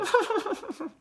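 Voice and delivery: high mocking giggle; girl's giggle. A narrator's reading. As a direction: giggle at him.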